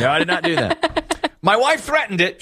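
high-pitched denial breaking into laughter; laughing denial. Real, not acted. Host laughing off cohost's teasing, denying through laughs. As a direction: protest through real laughter.

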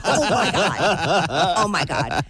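slow, laughing groan; laughing embarrassed. Real, not acted. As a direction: laugh at her own clumsiness.

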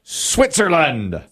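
high, drawn-out host's call; game-show reveal call. Real, not acted. Host asks the board to show Switzerland, drawn-out reveal call. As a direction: call for the board, stretching each word.

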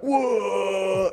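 high drawn-out whoa; imitated teens' whoop. Teens reacting to the loose dog, mock high whoop. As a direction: mimic a startled crowd of teens.

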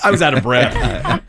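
real laughter breaking through the words; can't stop laughing. Real, not acted. Co-host retelling a beach umbrella mishap, laughing helplessly. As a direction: fight through a laughing fit. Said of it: "He can't stop laughing".

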